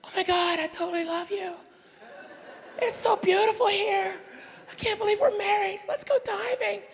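high-pitched, gushing honeymooner; movie newlywed. Acted. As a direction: play a lovestruck newlywed in a high voice. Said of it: "this is the whole preview right here".

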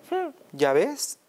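high, slow and smug; smug told-you-so. Acted. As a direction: drag it out, pleased with yourself. Mouse smugly shows Gruffalo.

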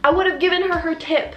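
ordinary talk, nothing marked

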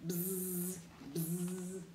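low, soft, steady buzz; fly buzzing. Acted. Flies circling the smelly pigeon, soft droning buzz. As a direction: drone quietly like flies.

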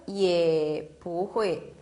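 也不会 said very slowly and low; slow low model reading. Shown as a demonstration. Teacher modelling the half third tone, slow and low. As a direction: draw out 也 low. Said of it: "반말하죠?".

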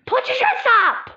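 high, loud crying shout; shrill yelled order. Acted. Girl yells at her sister to put her dress up, crying shout. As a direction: scream the order through tears. Girls quarrelling over a dress.

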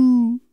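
siren ooh sliding high to low; siren wail. Shown as a demonstration. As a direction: swoop down like a siren. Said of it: "start high and then go low".

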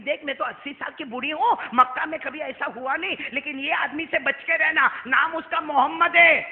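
put-on old woman's warning growing to full force; building preached warning.

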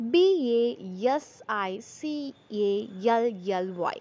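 slow letter-by-letter spelling; spelled out. Shown as a demonstration. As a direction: spell it out slowly, one letter at a time. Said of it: "we won't pronounce all the syllables".

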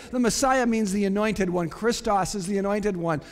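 usual speaking voice

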